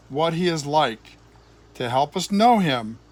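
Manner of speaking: ordinary delivery, nothing marked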